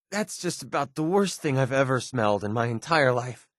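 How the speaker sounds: ordinary delivery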